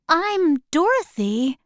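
put-on a small girl answering politely; young girl Dorothy. A narrator's reading.

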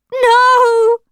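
high, loud scream of horror. Acted. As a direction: shriek in horror. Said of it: "Rachel screamed".

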